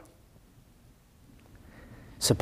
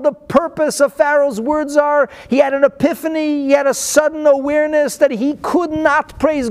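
raised, fervent sermon voice; preaching; 10 semitones higher than usual. Acted. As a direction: preach it high and urgent. Preaching builds in pitch.

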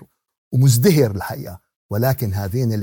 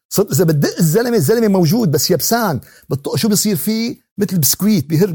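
fiery preaching; 6 semitones higher, 5 dB louder than usual. Acted. Vivid preaching about destroyed people.

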